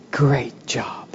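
soft whispered praise; whispered approval. Retold or imitated. God approving the killed idol, whispered. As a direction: breathe it like a secret blessing.

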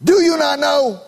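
fired-up preacher question; emphatic preaching cry. Acted. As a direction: pound the question home. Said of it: "uses it emphatically".

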